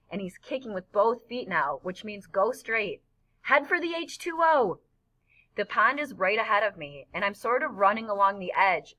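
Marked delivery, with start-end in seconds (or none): 3.4-4.8 high, ringing call; excited command. Acted. Boy directs his friend toward the pond, excited high call. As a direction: point the way with glee.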